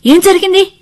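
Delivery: loud, high-pitched alarmed question; anxious alarm. Acted. Parents running in after hearing a scream, alarmed. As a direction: cry out in sudden worry. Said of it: "పరిగెత్తుకొచ్చారు ఆదుర్దాగా".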